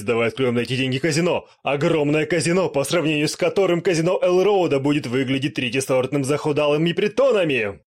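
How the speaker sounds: loud laughing, scheming boast; greedy gloating demon. Acted. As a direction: cackle and plan a casino. Demon gloating over gold.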